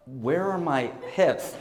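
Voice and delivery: slow, lost question through a laugh; laughing confusion. Retold or imitated. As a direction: ask it slowly, laughing at your own cluelessness. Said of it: "And I was like".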